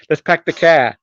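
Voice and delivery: mock Massachusetts accent, R's dropped. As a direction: imitate Massachusetts speech without R's. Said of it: "they drop their R's".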